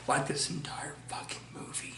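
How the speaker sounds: a sneering under-breath dig; whispered aside. Real, not acted. Reviewer trashing the movie to viewers, whispered aside. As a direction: drop to a whisper for the jab.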